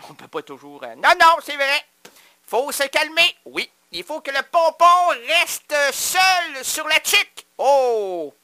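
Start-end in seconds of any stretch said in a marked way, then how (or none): 3.4-7.4 slow, lofty proclamation rising to tuque; grand proverb; proclaimed. Acted. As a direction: declaim it like a proverb. Proclaims a saying.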